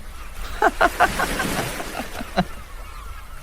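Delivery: high breathy giggle; teasing giggle. Acted. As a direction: giggle at your sister. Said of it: "Arcee jealously laughs at her".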